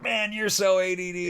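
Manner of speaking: high-pitched mimicked friend; teasing accusation. Friends tell the drummer what they think, mock exasperated. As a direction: imitate an exasperated pal's jab.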